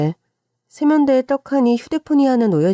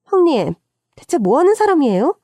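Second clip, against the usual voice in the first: high, indignant confrontation; raised-pitch acted scolding; angry outburst; 5 semitones higher than usual. A narrator's reading. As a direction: lash out at a sister-in-law. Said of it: "화를 내며 소리를 지르는 거예요".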